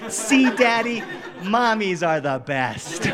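put-on high child voice slowing down; triumphant little girl. Small daughter to dad after rainbow returns, high, drawn-out triumph. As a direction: stretch it out as a proud toddler's verdict.

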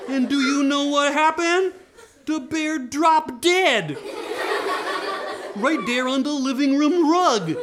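high, loud, drawn-out telling; astonished climax. A narrator's reading. Storyteller announcing the bear dropped dead, high loud astonishment. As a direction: build the shock, stretching the last words.

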